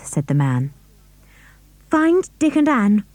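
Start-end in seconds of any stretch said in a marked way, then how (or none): 1.9-3.0 high, urgent command to the dog; girl urgently commands her dog. A narrator's reading. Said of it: "commanded George".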